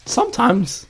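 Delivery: squeaky high cartoon voice, stretched out; vintage cartoon voice. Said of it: "You just sound like a cartoon character".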